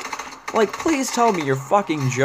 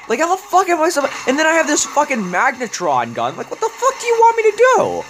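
furious yelling; 13 semitones higher, faster than usual. Real, not acted. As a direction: scream at the game in helpless rage. Frustrated gamer yelling at the zombie.

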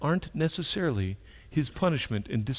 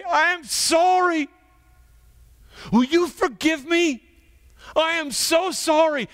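anguished apology; 10 semitones higher, 3 dB louder, slower than usual. Acted. Peter's unspoken apology.

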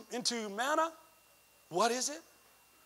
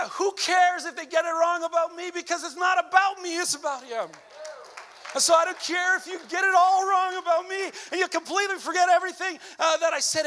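high, loud preaching; preaching at full force; 10 semitones higher, 7 dB louder than usual. Acted. Preacher rising to a shout before the church. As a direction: drive the point home to the congregation.

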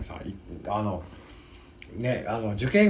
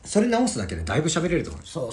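normal talking voice

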